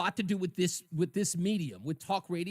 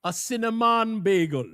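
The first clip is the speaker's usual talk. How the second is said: put-on louder joke punchline in a Jamaican accent; 3 semitones higher, 7 dB louder than usual. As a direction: land it with a put-on Jamaican lilt. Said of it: "What do you call a Jamaican bagel".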